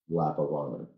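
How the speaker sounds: usual speaking voice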